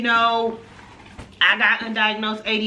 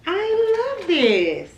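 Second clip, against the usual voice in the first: high slow gushing love; delighted; 11 semitones higher, much slower than usual. Real, not acted. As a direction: swoon over it. Delight at gift.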